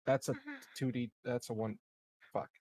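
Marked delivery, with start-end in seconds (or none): none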